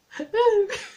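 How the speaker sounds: short high-pitched squeal of a laugh; high squeaky laugh. Real, not acted. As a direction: let out a squeaky giggle. Laughing at herself.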